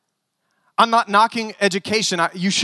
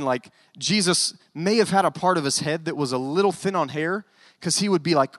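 ordinary talk

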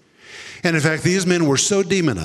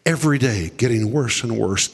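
ordinary delivery

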